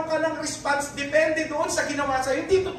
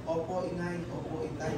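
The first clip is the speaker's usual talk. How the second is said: much softer, meek and respectful; soft obedient reply; 7 semitones lower, 13 dB quieter than usual. Retold or imitated. Child answering scolding parents, soft and obedient. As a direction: answer gently like a dutiful child. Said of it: "Anong response natin?".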